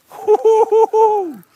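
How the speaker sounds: loud high whoops with laughter; excited whooping. Real, not acted. Fish pulling the boat.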